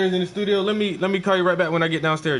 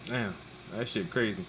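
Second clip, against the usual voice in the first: choppy phone line cutting out; bad signal, words dropping; breaking up; 6 semitones lower, 16 dB quieter than usual. Real, not acted. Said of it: "it sound like you breaking up".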